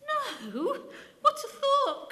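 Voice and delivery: a vicar crying out in mock horror at the name. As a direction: cry out in pretend horror. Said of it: "cried the vicar in mock horror".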